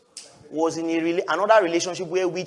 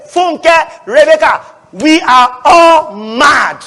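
loud, higher, laughing slow yell; laughing shout; 8 semitones higher, 11 dB louder, much slower than usual. Retold or imitated. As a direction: shout it through laughter, slowly. Voicing frank friends, laughing shout.

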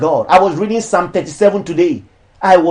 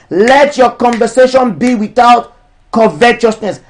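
raised, declamatory sermon voice; preaching; 3 semitones higher, 3 dB louder, slower than usual. Acted. Pastor preaching against charging for ministry, declamatory. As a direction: preach it to the church, each line landing.

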